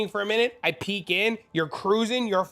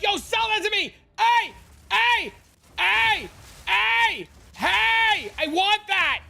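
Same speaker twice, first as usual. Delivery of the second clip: high, loud hammed-up shouting buyer; mock greedy buyer yelling; 14 semitones higher, 4 dB louder, slower than usual. Streamer mocking a pushy trade buyer, high shouting. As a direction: yell like a pushy buyer begging for the item.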